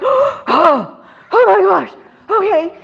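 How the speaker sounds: high, gasping retold shock; stunned reaction. Seller reacting to realtor's coincidence, stunned. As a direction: take it in, high and amazed.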